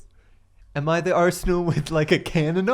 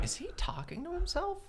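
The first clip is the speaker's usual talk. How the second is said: quiet aside; 9 semitones higher, 20 dB quieter than usual. Retold or imitated. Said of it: "I'm sitting there going".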